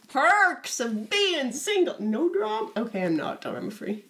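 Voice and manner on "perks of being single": high, drawn-out mock boast; crowing. Teased about being drama-free, crowing in reply. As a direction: crow it slowly and high.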